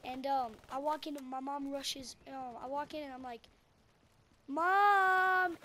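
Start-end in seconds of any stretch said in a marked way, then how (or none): 4.5-5.6 very loud, high, long holler; stretched-out shout across a room; calling for Mom. Retold or imitated. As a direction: reenact a kid yelling for his mother. Child calls out for his mom.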